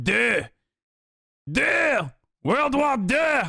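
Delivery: drawn-out fake French, climbing high; slow, hammed-up French accent rising to a high pitch; mock French accent. Streamer insisting on French for two, hammed-up French accent. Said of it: "French accents".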